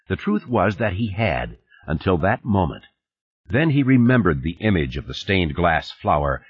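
usual speaking voice

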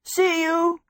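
high, called-out farewell; shouting. A narrator's reading. Michael as the castle trundles away, shouting. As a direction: call it high into the distance. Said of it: "he shouted".

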